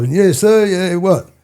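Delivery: high, loud hammed-up yes sir; mock meek yes sir. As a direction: squeak out deferential replies. Boy answering policeman.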